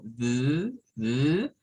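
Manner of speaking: "d" as a voiced th buzz, "dhh"; isolated "d", tongue buzzing as in "the"; vibrating TH. Shown as a demonstration. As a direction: sustain the voiced th alone. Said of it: "T H al principio vibrante como".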